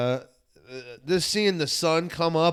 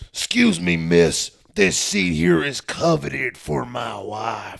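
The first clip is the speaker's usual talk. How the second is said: put-on slow, drawn-out old Southern man; old man in overalls; 2 semitones lower, slower than usual. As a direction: imitate a country old-timer, stretching the words out.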